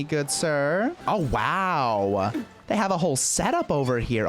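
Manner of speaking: ordinary delivery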